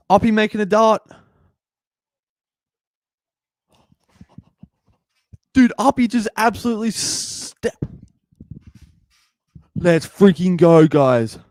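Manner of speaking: very high, loud screaming of joy; ecstatic yelling. Real, not acted. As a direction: lose it completely as he scores the break. Commentator erupts at try-saving dash.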